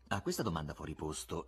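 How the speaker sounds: ordinary delivery, nothing marked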